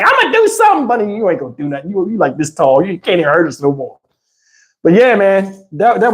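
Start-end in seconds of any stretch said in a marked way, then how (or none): 0.0-0.9 high squeaky mock threat; mock tough guy. Comedian mocks Bunny's empty threat in a high squeaky voice. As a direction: squeak it out like a small kid acting tough.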